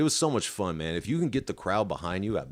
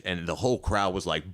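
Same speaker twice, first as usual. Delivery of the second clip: ordinary delivery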